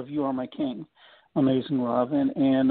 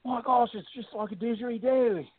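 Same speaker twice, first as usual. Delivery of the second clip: amazed Australian, pitched well up; imitating Australian accent; 8 semitones higher than usual. Quoting the guitar player about the didgeridoo sound, in mock Australian accent. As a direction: put on a high, rough-attempt Australian accent. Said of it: "I can't do the Australian accent quite like he does".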